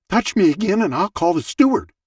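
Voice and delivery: a hint of the testy lady warning him off; grumpy woman. Grumpy woman in front threatening to call the steward, snappy.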